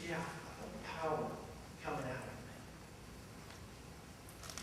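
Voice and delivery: soft, quick, calm reply; Jesus answering his disciples, softly. Retold or imitated. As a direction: answer them quietly.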